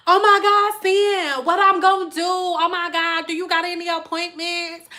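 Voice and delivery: mock panic, high and loud at first; a frantic client begging the stylist, mock panic; panicked client. Panicked client voiced begging appointment.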